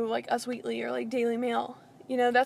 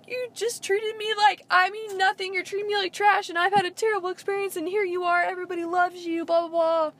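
higher pitched, hammed-up upset tirade; mock tearful rant; 7 semitones higher than usual. Imitating Caitlyn going crazy on him, high and upset. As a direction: rattle off hurt complaints, half-crying. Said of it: "goes crazy on him".

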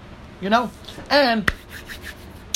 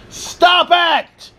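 sudden high shout; 12 semitones higher, 4 dB louder than usual. Real, not acted. Ranting at complaining fans, a sudden high shout. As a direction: bark it at the whiners.

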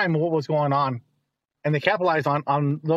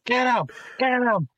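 mock ringside yell; 9 semitones higher than usual. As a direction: urge the wrestler on like a ringside fan. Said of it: "yelling at the T V".

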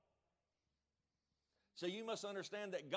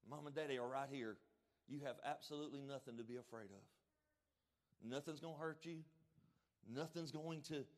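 put-on soft, tender reassurance to a frightened child, growing hushed; soothing parent; 3 semitones lower, 4 dB quieter than usual. Parent comforting a scared child at night, soft and tender. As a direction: lull the child to rest, gently. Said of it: "our kids will come and say".

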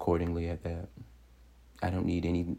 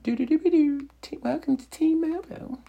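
high, loud singsong phone-company welcome; mock jingle greeting; 6 dB louder than usual. Speaker spoofing a phone company's welcome, mock jingle greeting. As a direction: chirp it out like a jingle in very high pitch.

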